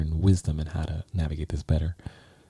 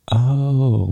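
startled oh; 7 semitones higher, 4 dB louder than usual. Retold or imitated. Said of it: "she's like".

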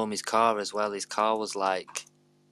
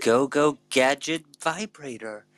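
Inspector Gadget impression; 5 semitones higher, 4 dB louder, slower than usual. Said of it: "Could you do his voice?".

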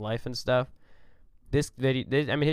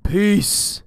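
loud sign-off; 8 semitones higher, 8 dB louder than usual. Acted. Podcast sign-off call.

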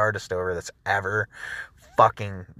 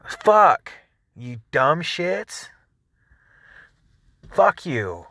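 angry shout; 5 semitones higher, much slower than usual. Real, not acted. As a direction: blurt it out in real frustration. Ranting at Facebook.

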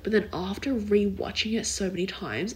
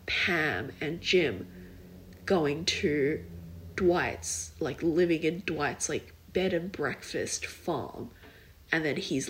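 ordinary talk

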